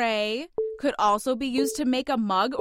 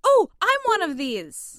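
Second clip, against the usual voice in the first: high, loud eager clue; bursting to give the answer; excited; 8 semitones higher, 7 dB louder than usual. Acted. Mushroom excitedly gives clue.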